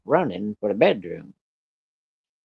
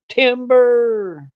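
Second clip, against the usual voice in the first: high, loud drawn-out call; hollered timber; 12 semitones higher, 4 dB louder than usual. Retold or imitated. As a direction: yell timber across the yard as the pole falls. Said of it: "And I hollered".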